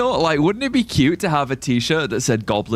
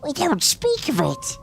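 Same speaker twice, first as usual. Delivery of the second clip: high squeaky voice; 14 semitones higher than usual. Pretend. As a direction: repeat it as a tiny squeak. Repeated in another voice.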